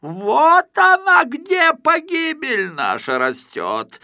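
a sputtering rage at the TV; furious yelling. A narrator's reading. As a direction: shout it, spitting with fury. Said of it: "заорал, брызгая слюной".

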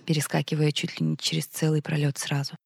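ordinary delivery, nothing marked

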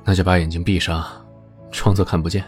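low gentle coaxing. A narrator's reading. 061 coaxing.

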